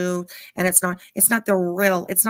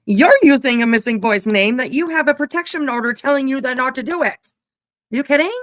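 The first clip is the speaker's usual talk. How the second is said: angry retort; 5 semitones higher, 6 dB louder than usual. Real, not acted.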